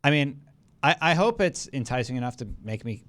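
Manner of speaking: ordinary talk, nothing marked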